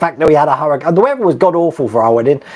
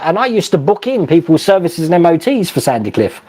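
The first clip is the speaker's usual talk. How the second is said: normal talking voice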